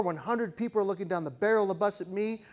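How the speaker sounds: ordinary talk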